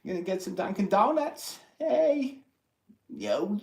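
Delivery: put-on high-pitched caricature Boston accent; playful Boston impression, high and calling out. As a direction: ham up a Boston local, voice way up. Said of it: "trying to do the Boston".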